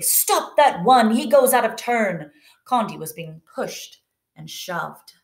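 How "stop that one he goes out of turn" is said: storybook angry shout, higher and louder; indignant, raised-voice scolding; angry yell. A narrator's reading. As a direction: yell at a queue-jumper, as read aloud. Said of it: "The woman began yelling angrily".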